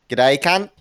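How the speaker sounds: loud, high-pitched Australian greeting; Australian accent. Shown as a demonstration. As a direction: belt out a cheeky Australian g'day. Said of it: "Can he do an Australian accent?".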